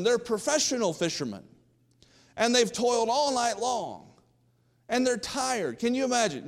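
preacher building the story; fervent preaching. Acted. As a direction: rouse the congregation. Preaching at full force.

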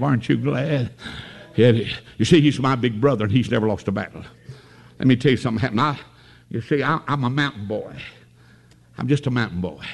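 normal talking voice

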